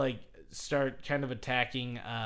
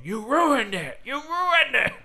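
loud, much higher shout that climbs on the repeat; retold yelling; 11 semitones higher, 7 dB louder than usual. Vince McMahon yelling at Steve Austin, imitated loud shout. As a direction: yell it at him like he wrecked the show. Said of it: "Vince McMahon is just yelling at".

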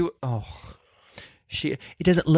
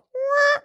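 a much higher, squawky seagull impression; mock seagull cry. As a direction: squawk like a gull, badly on purpose. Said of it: "That's not what a seagull sounds like".

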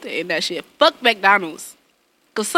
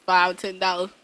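ordinary delivery

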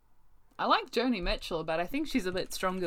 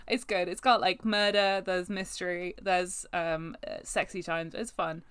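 ordinary talk

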